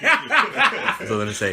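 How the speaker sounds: slow, high, laughing through it. Real, not acted. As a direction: drag it out while cracking up. Repeats the same line, laughing.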